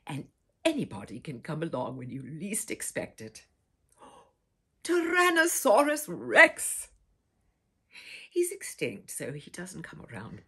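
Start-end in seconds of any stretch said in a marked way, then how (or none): 4.0-6.9 amazed gasp. Acted. Child spotting a dinosaur through the window, gasping amazed. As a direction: gasp then slow, louder, higher exclamation.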